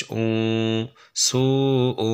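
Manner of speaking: "উ" held long with a nasal hum; ghunna. Shown as a demonstration. Teacher showing ghunna after madd, long nasalised "উ". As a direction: stretch "সু", then hum "উ" through the nose. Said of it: "এখানে আমরা গুণনা করব".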